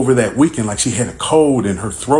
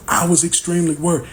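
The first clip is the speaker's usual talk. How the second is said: ordinary delivery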